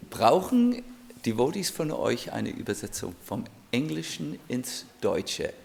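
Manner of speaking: ordinary talk, nothing marked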